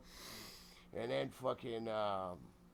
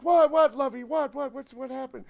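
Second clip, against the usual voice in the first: high, loud, fretful grandmother voice; pitched-up mock panic; imitated alarmed grandma; 12 semitones higher, 13 dB louder than usual. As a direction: repeat it in acted mounting alarm. Voicing alarmed grandmother seeing blood.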